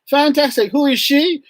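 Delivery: high-pitched eager praise; enthusiastic teacher. Retold or imitated. Teacher prompting the class after a right answer, enthusiastic. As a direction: cheer them on, then quiz them brightly.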